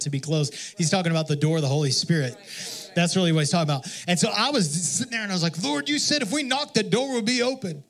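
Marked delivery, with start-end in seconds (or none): 5.6-7.8 high insistent plea to the Lord; pleading prayer. Retold or imitated. Preacher retells knocking on the glass door, high pleading prayer. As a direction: claim the promise, pitched up high.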